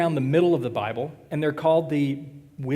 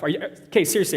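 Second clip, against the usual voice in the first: ordinary talk, nothing marked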